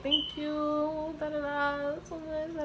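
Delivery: a lightly voiced gushing customer; mock grateful customer. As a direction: sweetly thank the hairdresser in mock. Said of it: "You'd be like".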